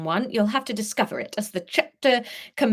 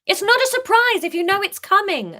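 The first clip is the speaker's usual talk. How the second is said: high loud snap; loud high retort; sharp snap; 13 semitones higher, 7 dB louder than usual. Acted. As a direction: snap it back at him. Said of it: "Leah snapped back".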